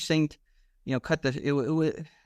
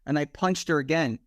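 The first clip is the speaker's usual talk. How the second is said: ordinary talk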